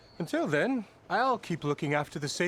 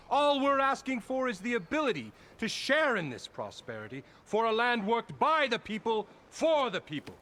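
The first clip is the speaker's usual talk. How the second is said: higher and fervent, like a rally speech; rousing plea; 6 semitones higher than usual. Acted. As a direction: preach it to the workers.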